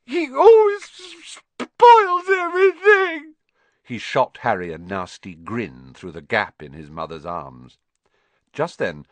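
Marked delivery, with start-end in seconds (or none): 0.1-3.3 very high, much louder bawling with fake sobs; high, loud, blubbering tantrum yell; fake-sobbing yell. A narrator's reading. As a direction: wail each word like a spoilt child. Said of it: "Dudley yelled between huge pretend sobs".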